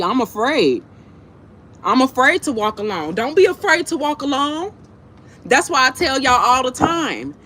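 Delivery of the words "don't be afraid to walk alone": ordinary talk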